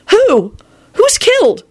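high, loud shocked questioning; aghast. A narrator's reading. Elizabeth asking who was killed, aghast. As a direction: demand it in horrified high pitch. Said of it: "with an aghast expression".

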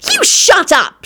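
high-pitched, cross retort; snapped. A narrator's reading. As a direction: snap back way up high. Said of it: "she snapped".

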